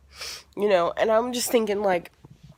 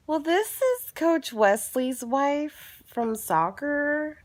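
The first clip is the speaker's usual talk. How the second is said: slow, deliberate and higher; pointed slow reply; 9 semitones higher, much slower than usual. Retold or imitated. Telling the other mom who she is, slow and pointed. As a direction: spell it out word by word.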